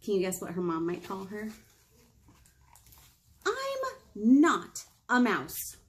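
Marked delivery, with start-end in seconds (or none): 3.4-5.8 slow, emphatic title read; reading to children. A narrator's reading. Librarian reading the book aloud to kids, slow and clear. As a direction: read it slowly for little ones.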